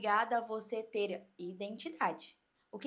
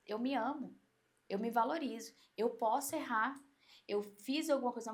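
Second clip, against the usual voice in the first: ordinary talk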